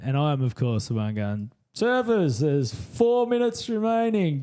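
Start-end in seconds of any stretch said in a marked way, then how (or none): 1.8-4.4 mask your voice on the mic; disguised announcer voice. Retold or imitated. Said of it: "trying to put on an accent, muffle my voice".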